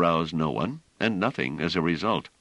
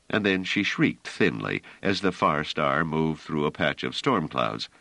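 usual speaking voice

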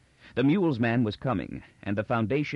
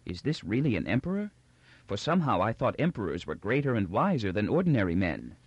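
usual speaking voice

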